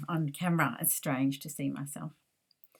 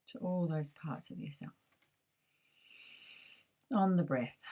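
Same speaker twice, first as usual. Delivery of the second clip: guided meditation; 3 dB quieter than usual. Acted.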